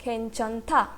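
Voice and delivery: खेंचंथा syllable by syllable, खेन-चन-था; pronunciation demo. Shown as a demonstration. Teacher models the whole word, sounding out खेंचंथा by syllable. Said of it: "पूरे word का pronunciation क्या हुआ".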